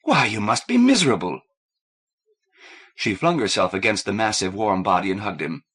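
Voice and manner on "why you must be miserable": urgent concern. A narrator's reading. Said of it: "Kathleen cried".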